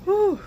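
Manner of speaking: high, loud blown-out exhale; loud exhausted whew. Real, not acted. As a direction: puff it out like you just made it. Worn out after the snow.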